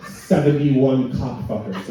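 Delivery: ordinary talk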